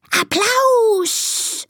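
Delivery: high call with long hissed ending; hissing snake. Acted. Snake cheering the elephants, high hiss. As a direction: stretch the final S into a hiss. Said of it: "zischt die Schlange".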